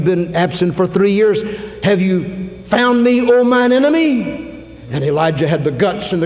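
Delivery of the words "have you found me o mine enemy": high, slow, sneering king's challenge; a scornful royal voice, drawn out and high; accusing king. Acted. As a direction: taunt him as the hostile king. King confronting Elijah.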